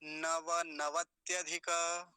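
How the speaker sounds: slow, stretched whole word; deliberate, connected demonstration; joined-up model. Shown as a demonstration. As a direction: say the compound slowly in one run. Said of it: "पूरा मिलके क्या बनेगा".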